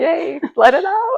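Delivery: high, slow coaxing "yay"; cheering on her guest's tears; encouraging cheer. Real, not acted. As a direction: coax her to let it out.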